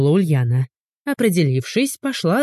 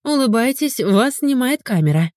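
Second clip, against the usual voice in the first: higher, playfully cheerful announcement; 7 semitones higher than usual. A narrator's reading. Ulyana points phone camera at the stranger, cheerful. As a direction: chirp it like a gleeful photographer. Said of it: "весело произнесла".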